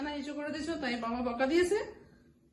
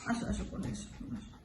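whisper; 4 semitones lower, 12 dB quieter, faster than usual. Real, not acted. Coaxing pet into lap.

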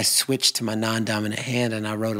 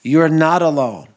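louder, higher, embracing reassurance; reassuring call; 4 semitones higher, 8 dB louder than usual. Acted. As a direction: call out to comfort them.

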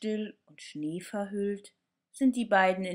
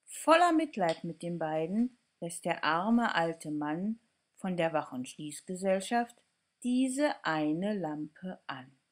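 usual speaking voice

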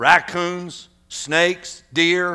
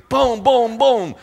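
rapid mock gunshots, louder; shotgun booms; 7 semitones higher, 3 dB louder, slower than usual. Boy firing again and again, mock gunshots. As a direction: fire off each boom.

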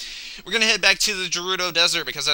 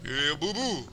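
Yogi Bear voice; 8 dB quieter than usual. Retold or imitated. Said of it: "good Yogi voice".

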